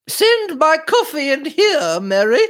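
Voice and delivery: calling out. A narrator's reading. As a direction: call out high, stretching the words. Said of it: "she called".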